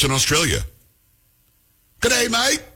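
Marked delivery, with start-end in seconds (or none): 2.0-2.6 high, hammed-up Aussie greeting; cheery fake Aussie g'day; mock Australian. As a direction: throw on a jokey Australian accent. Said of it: "That's for our Australian friends".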